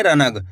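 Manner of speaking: रनग with a whole na, ra-nag; full n. Shown as a demonstration. Teacher repeating the mistake for learners, रनग with a full na. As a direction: sound the na fully in रनग. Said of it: "ना का पूरा उच्चारण हो रहा है".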